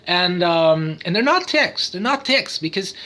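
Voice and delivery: ordinary talk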